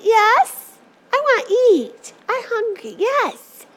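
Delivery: put-on high little-kid voice, eager; whiny hungry girl. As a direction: play a small girl who needs food. Hungry girl tells mom she's hungry.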